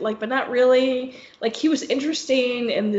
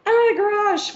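exasperated; 11 semitones higher, 4 dB louder than usual. Retold or imitated. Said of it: "But I'm like".

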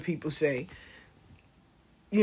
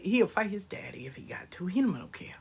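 soft, whispery aside; hushed aside; 8 dB quieter than usual. Real, not acted. Grandmother confiding about her feisty grandson, hushed aside. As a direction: drop to a near-whisper, confidingly.

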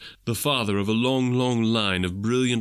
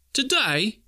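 put-on boy wondering; 11 semitones higher than usual. A narrator's reading. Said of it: "replied the boy".

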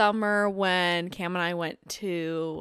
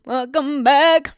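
drawn-out host voice; 5 semitones higher, 10 dB louder, much slower than usual. Pretend. Co-host echoes the drawn-out welcome.

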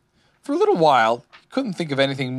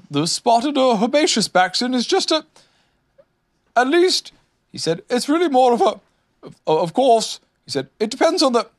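high, halting bluff; blustering; 12 semitones higher, 5 dB louder than usual. A narrator's reading. Owl pretends to know about Baxons, blustering. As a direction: start grandly and break off.

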